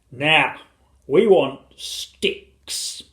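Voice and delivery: loud, very slow instruction; drawn-out, commanding dad; father giving orders. A narrator's reading. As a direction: spell out the task grandly.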